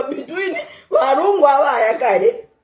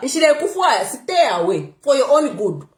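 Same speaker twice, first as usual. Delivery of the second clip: ordinary talk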